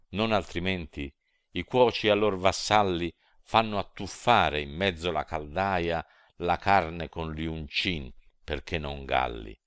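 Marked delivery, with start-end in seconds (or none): none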